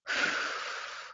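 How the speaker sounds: long, soft exhale; long breathed-out sigh. Shown as a demonstration. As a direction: let a slow breath out audibly. Models natural breathing for the group.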